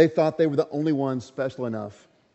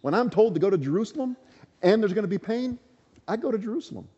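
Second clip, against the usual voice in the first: rising resolve; 8 semitones higher, faster than usual. Acted. Paul insists on going.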